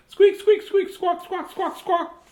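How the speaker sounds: very high squeaky bird noises; bird chirps. Acted. Parent reading to child squeaks as the bird. Bird voiced in picture book.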